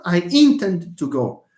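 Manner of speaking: "intend" stressed on IN; stress on first syllable. Shown as a demonstration. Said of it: "it's not".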